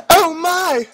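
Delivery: high, loud falsetto lady shout. A narrator's reading. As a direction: squeal it out as the startled girl. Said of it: "she shouted".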